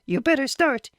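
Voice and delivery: high urgent warning; lightly voiced wife. A narrator's reading. Wife warns husband as son comes, lightly voiced. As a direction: hurry him along.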